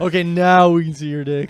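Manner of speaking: hammed-up shouted line; mock yell. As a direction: bellow it at the crowd. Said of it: "He's like".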